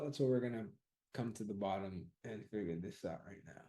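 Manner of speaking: ordinary talk, nothing marked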